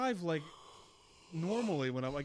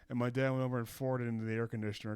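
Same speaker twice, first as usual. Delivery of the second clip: normal talking voice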